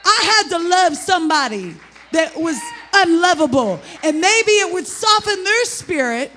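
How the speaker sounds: loud, high-pitched sermon shout; impassioned preaching. Real, not acted. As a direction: preach it out at full force. Preaches at full force.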